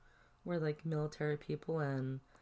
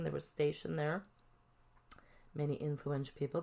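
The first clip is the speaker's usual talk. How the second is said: usual speaking voice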